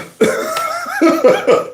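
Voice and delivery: very high squealing laugh; real high giggling fit; mocking laughter. Real, not acted. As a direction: burst out laughing at him. Laughs at the crying man.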